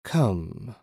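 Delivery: drawn-out, understated command; lightly voiced commanding apparition; demanding female voice. A narrator's reading. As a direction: summon him as a disembodied woman. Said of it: "the female voice demanded".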